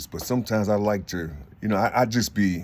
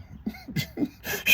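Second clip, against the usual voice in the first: a high, breathless wheeze of a laugh; wheezing laugh; 6 dB quieter than usual. Real, not acted. Laughing high at the brother's jab, breathless. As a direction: let a squeaky silent laugh slip out.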